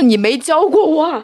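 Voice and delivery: high whiny protest. Retold or imitated. Retold protest to teacher.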